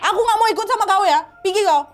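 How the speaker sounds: high-pitched, agitated confrontation; acted frightened, angry outburst; snapping angrily. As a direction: challenge an impostor and drive it away. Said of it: "Arsen langsung bentak".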